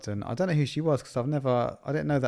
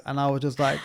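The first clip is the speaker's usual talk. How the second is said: ordinary talk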